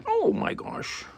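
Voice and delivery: very high airy gasp of delight at the cat; squeaky high pet talk. Real, not acted. Cooing at the cat.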